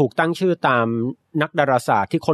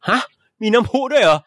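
high-pitched startled double-take; incredulous, voice jumping up; mock surprise; 9 semitones higher than usual. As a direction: react as if caught off guard by a find. Acting surprise at accidental discovery.

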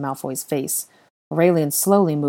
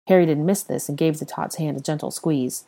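ordinary delivery, nothing marked

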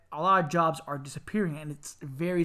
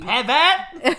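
higher, louder teasing pivot shout; Friends pivot quote; 9 semitones higher, 8 dB louder than usual. Retold or imitated. Teasing co-host about changing careers, shouted Friends catchphrase. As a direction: throw the catchphrase back. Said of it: "that's from Friends".

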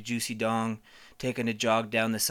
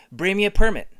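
loud demand; 6 semitones higher, 9 dB louder than usual. Pretend. First demand for permit.

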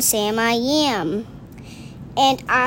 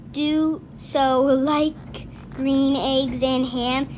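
slow finale; slower than usual. Real, not acted. Final line read slowly.

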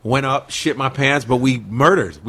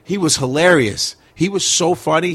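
usual speaking voice

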